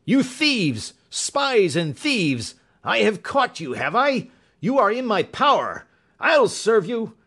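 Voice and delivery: high, loud raging villain; furious shriek. Acted. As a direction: rage at the intruders, shrieking threats. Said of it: "he shrieked".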